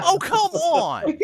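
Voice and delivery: high, loud laughing yell; frustrated shout. Real, not acted. Dice fail, shouted in dismay.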